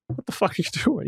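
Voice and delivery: high, incredulous, with a laugh breaking in; baffled juror. Retold or imitated. Juror stares at a showy lawyer, disbelieving. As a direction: imitate a juror who can't believe it. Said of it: "the jurors are looking at him like".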